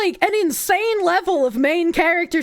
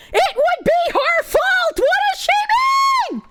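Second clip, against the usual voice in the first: furious rant; 8 semitones higher than usual. Real, not acted. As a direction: shout your outrage at her.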